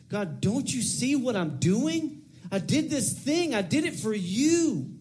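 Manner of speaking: high, slow, exaggerated pleading with God; demanding attitude. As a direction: stretch it out, insisting on credit for the deed. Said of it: "Maybe not with that attitude".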